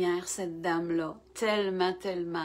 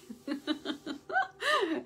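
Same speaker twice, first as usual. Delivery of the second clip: short laugh; 7 semitones higher, 3 dB quieter than usual. Real, not acted. Greeting viewers, laughing.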